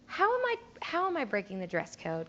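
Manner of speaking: sugar-coat the question through clenched patience; mock sweet. Sweetly asking the kid back.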